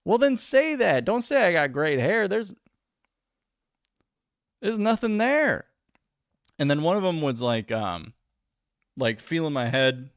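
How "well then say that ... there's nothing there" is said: high, loud incredulous; exasperated rant. Real, not acted. Ranting to viewers about the women, high exasperated. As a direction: rant at the absurdity.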